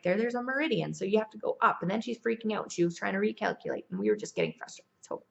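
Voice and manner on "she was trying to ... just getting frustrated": ordinary delivery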